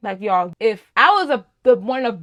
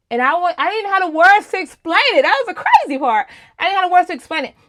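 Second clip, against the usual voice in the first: louder; 6 semitones higher, 5 dB louder than usual. Real, not acted. Emphatic testimony.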